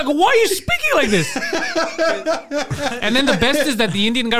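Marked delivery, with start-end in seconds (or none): 0.1-1.3 very high, incredulous question; mock bafflement. Man questioning his friend's accent switch, high mock bafflement. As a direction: squeal it in laughing disbelief.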